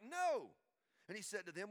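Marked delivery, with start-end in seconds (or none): 0.0-0.6 a high, loud, blunt no; loud flat no. Retold or imitated. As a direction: shout the no back across the water. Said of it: "And they're like".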